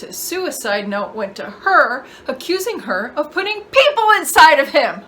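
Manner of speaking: emotional telling that rises sharply; pained revelation. Acted. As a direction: voice climbing high and loud. Acted painful family revelation.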